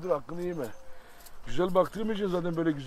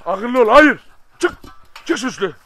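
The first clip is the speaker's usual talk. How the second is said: horoza sertçe bağır, giderek yükselen; uyarırcasına yüksek ve tiz haykır; gerçek bağırış; 5 semitones higher, 12 dB louder, slower than usual. Real, not acted. (horoza kızgın bağırış)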